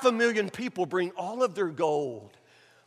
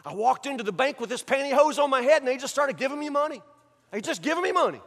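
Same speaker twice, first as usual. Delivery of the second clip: high, mock-innocent explanation; excuse-maker; 5 semitones higher, faster than usual. Robber explaining the bank money, mock-innocent. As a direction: play baffled innocence in a raised pitch. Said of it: "that's how people talk".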